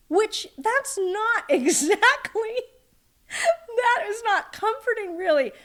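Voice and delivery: high, slow words through laughter; laughing. Real, not acted. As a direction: struggle to talk while laughing. Laughs at mother's grim remark.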